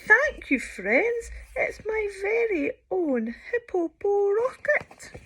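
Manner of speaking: high and loud with delight; excited thanks. Acted. Sheila thanking her friends, high and thrilled. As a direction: beam with pride at the rocket.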